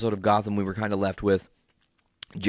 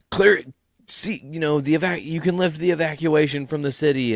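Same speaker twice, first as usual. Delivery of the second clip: mayor voice; 8 semitones higher, 4 dB louder than usual. Retold or imitated.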